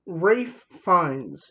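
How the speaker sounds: "Ralph Fiennes" said slowly as RAFE FINES; "Ralph" rhymes with safe, "Fiennes" with lines; careful name pronunciation. Shown as a demonstration. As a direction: stretch out "Ralph Fiennes" as rayf fynz. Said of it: "It's so hard to pronounce".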